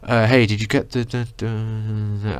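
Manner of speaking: slow down and slur into repeated syllables; trailing off. Retold or imitated. Guy asking a groupmate something, trailing off slowly. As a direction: imitate a question dissolving into a drawn-out mumble. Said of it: "he, like, trails off everything he says".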